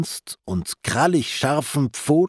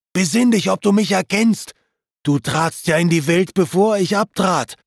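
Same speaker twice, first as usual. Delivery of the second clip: raised, pleading call, pitch higher; 6 semitones higher than usual. A narrator's reading. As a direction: urge him to remember you. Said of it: "rief er". (flehend rufend, höher)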